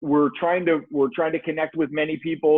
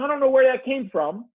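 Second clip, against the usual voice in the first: ordinary delivery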